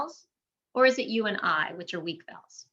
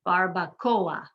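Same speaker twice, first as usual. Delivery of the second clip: slow "Barbacoa" with stress on CO; second-to-last syllable stressed; much slower than usual. Shown as a demonstration. Teacher showing the rule, "Barbacoa" stressed on CO. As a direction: keep O and A separate in "Barbacoa", hitting O. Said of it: "emphasizing the second to last".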